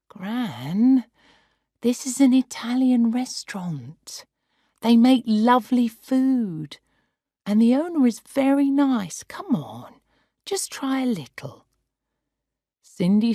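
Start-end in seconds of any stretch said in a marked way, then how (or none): 0.1-11.6 put-on higher, pleading young voice; coaxing granddaughter. Granddaughter coaxing her grandmother to eat, higher pleading voice. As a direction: gently talk her round. Cindy coaxes gran.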